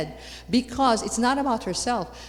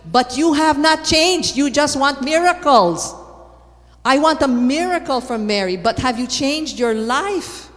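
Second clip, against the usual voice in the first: forceful rebuke; 6 semitones higher, 7 dB louder than usual. Real, not acted. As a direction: shake them awake with the challenge. Preacher rebukes congregation.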